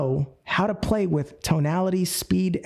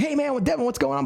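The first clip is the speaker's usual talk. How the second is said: rapid high-pitched hype greeting; fast, excited talk; 10 semitones higher than usual. Shown as a demonstration. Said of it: "Caesar normally talks fast".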